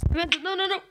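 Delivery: shrieking as the monster hits; panicked scream. Real, not acted. Dies in a horror game. (grito de pânico agudo e alto)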